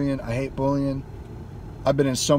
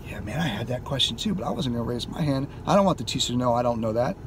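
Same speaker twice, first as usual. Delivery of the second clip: sheepish mumble; 6 semitones lower, 6 dB quieter, faster than usual. Retold or imitated. Classmates admitting they didn't ask.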